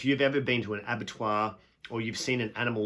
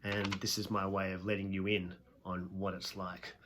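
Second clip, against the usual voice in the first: quiet earnest God; 3 semitones lower, 6 dB quieter than usual. Retold or imitated. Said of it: "And God goes".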